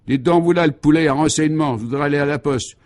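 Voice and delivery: loud, high, surly demand; rude hailing. A narrator's reading. Passer-by rudely asking a policeman the way, surly. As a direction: accost him rudely and loudly.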